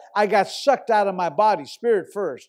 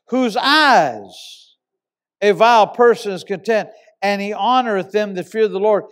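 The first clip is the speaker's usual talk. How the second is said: scripture reading; 5 dB louder than usual. Acted. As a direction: preach the verse out to the hall. Preacher reads a psalm aloud.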